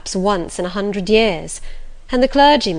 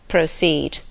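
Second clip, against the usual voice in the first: deep low intonation; 3 semitones lower than usual. A narrator's reading. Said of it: "with deep but low intonation".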